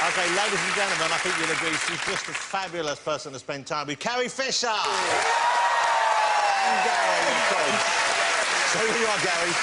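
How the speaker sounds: loud, high TV host announcement; big stage intro for the crowd; showbiz announcer. Acted.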